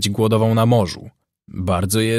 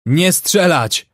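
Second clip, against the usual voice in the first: high, slow shout; shouted order; 7 semitones higher, 4 dB louder, slower than usual. A narrator's reading. Brown orders his men to hold fire, shouting. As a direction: shout a drawn-out command. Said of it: "krzyknął Brown".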